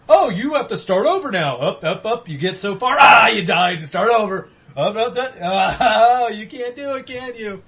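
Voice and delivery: high, loud mocking taunt with shouts; sing-song the game mocking the player. The game taunting him as he keeps dying, loud mocking voice. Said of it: "when I'm just like".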